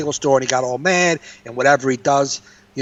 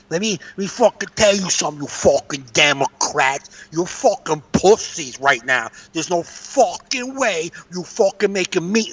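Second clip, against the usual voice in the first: put-on New York accent; 4 semitones higher than usual. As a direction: imitate a New York tough-guy tirade. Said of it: "she sounds like every Nicky Nork guy you know".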